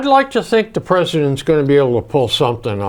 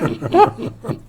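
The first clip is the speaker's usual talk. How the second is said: amused laugh; 6 semitones higher, 3 dB quieter than usual. Real, not acted. Laughs at own retold joke.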